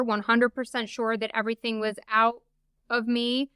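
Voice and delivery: usual speaking voice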